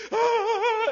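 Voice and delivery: high, loud mock cry; imitated sobbing wail. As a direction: ham up a weeping girl's wail. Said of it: "she was like".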